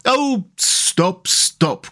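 high plea, dropping on the repeat; overwhelmed with delight; ecstatic cry. A narrator's reading. Mole begging Rat to stop listing food, ecstatic. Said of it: "cried the Mole in ecstasies".

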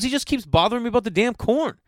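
high-pitched imitated griping; hammed-up exasperation; exasperated rant. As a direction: gripe like a cook at the end of his rope. Acting out friend's exasperated complaint.

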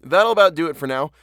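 usual speaking voice

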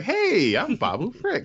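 high, bouncy little alien hello; lightly voiced tiny alien introducing himself; mock cheerful tiny alien; chirpy alien greeting. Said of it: "he's like".

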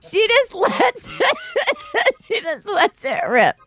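real laughter breaking up the words; laughing. Real, not acted. Telling mom's grocery store fart story, really cracking up. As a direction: squeal with laughter mid-sentence, barely getting it out.

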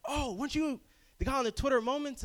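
imitating strangers. Stranger recognises him, high eager voice. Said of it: "we're like".

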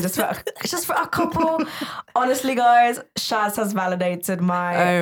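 lightly put-on British accent; a playful touch of British accent. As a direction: play at sounding British for a moment. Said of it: "talk in my British accent".

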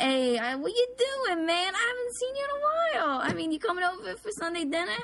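high-pitched South Philly Italian caricature; pushy Italian-American relative impression; loud, obnoxious Italian-American accent. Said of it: "They're really loud, very obnoxious".

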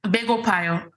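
"Begopayo" said level, no stress on any syllable; "Begopayo" even and flat as a plain statement; flat Korean tone. Shown as a demonstration. Showing the host a flat Korean statement, "Begopayo" kept level.

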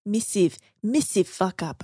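put-on Australian accent drifting into New Zealand; bad Australian accent. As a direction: try the Australian missive for massive. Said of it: "I can't do an Australian accent very well".

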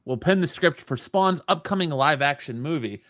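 loud, high-energy headline reading; news read. Real, not acted. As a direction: announce it like breaking entertainment news. Reads news item.

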